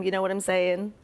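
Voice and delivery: ordinary delivery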